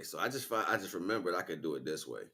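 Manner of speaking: ordinary talk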